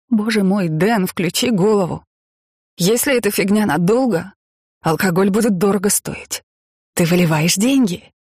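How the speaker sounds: выше, с возмущением; отчитай мужа на повышенных тонах; раздражённый упрёк. Acted. Ссора супругов из-за вылитого вина. (раздражённо, с упрёком)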